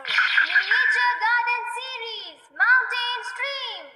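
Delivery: slow, high, loud gadget reveal; a proud reveal of a new gadget; gadget announcement. Acted. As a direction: draw out the gadget name with fanfare. Robot cat presents a gadget.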